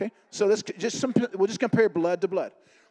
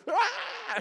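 retold freak-out scream. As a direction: imitate her freaking out with a high "Ah!". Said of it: "She starts freaking out".

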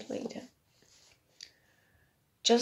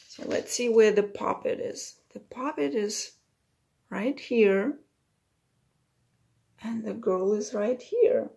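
usual speaking voice

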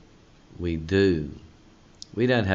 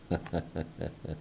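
quiet joyful chuckle; a brief delighted laugh under the breath; soft chuckle; 10 dB quieter than usual. Real, not acted. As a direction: let a small happy laugh slip out. Laughs after reading verse.

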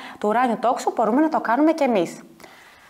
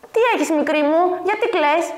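lightly voiced kindly painter, a little higher; concerned painter; 6 semitones higher than usual. A narrator's reading. Painter asking the little fairy why she cries, lightly concerned. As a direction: ask a small child what is wrong. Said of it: "τον κύριο Πικασόρο".

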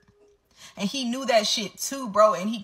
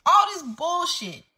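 higher, slow exasperated scoff; 8 semitones higher, 3 dB louder, slower than usual. Real, not acted. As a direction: throw it off higher in disbelief. Repeats phrase, raised pitch.

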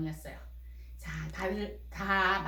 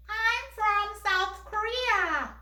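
put-on much higher, very slow child voice answering; 높은 아이 목소리; 9 semitones higher, much slower than usual. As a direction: answer slowly in a high little-girl voice. 미미 역할로 대답. (미미 역할로 높고 천천히 대답하는 목소리)

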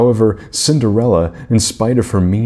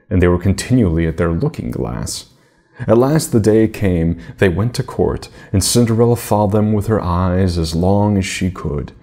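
ordinary delivery

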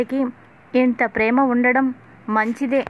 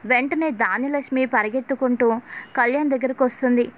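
ordinary delivery

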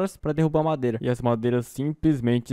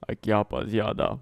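put-on low, slow old man voice; 6 semitones lower, 3 dB quieter, slower than usual. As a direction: talk like a creaky old man. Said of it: "vou ter que fazer uma voz de velho".

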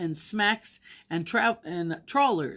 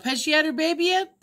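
put-on eager child; 7 semitones higher, 4 dB louder, faster than usual. A narrator's reading. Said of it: "Another spoke up".

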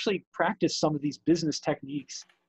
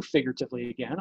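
ordinary talk, nothing marked

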